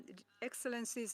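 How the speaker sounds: ordinary talk, nothing marked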